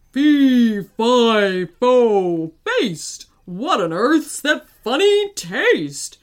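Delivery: big loud slow giant voice; booming giant voice. A narrator's reading. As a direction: boom it out like a giant. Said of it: "in his big, booming voice".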